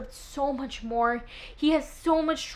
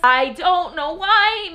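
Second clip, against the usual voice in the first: slow, higher and louder, why falling; helpless gushing; 6 semitones higher, 7 dB louder, much slower than usual. Real, not acted. Confessing to viewers why she fell for him, slow and swooning. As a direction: swoon over it.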